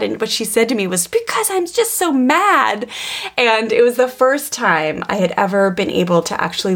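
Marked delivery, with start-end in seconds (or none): 1.1-2.9 put-on high, slow, whiny child's voice; upset little daughter. Mother quoting her angry daughter, high slow childlike whine. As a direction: imitate a mad toddler, pitched way up and dragged out.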